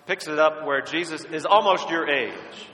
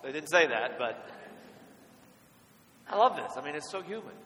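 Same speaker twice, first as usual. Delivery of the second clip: usual speaking voice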